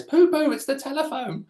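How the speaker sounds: high sing-song call up the stairs; calling upstairs. Retold or imitated. She called up the stairs.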